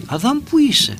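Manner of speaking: calling out high, simple and direct; God calling Adam. Retold or imitated. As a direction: call the name up high, like a voice from above. Contrast with a commanding call.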